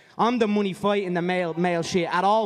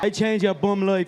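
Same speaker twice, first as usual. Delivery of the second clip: ordinary talk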